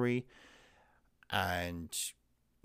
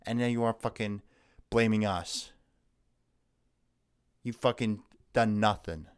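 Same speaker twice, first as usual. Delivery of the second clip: ordinary talk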